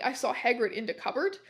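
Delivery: ordinary delivery, nothing marked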